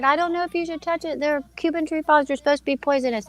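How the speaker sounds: fast high nervous warning; anxious warning. Retold or imitated. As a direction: rush to warn her it's poisonous. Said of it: "I said".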